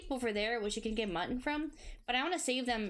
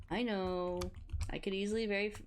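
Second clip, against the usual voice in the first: ordinary talk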